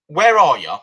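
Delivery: loud, demanding phone yell; where-are-you yell at the no-show seller; exasperated shout. Retold or imitated. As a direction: call him out for being late.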